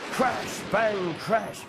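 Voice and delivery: ordinary delivery, nothing marked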